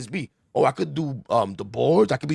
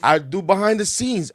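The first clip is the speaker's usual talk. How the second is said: louder, higher, pressing your case; insistent plea; 6 semitones higher, 5 dB louder than usual. Retold or imitated. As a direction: re-enact an eager, emphatic bid for any job. Retold pleading for any job.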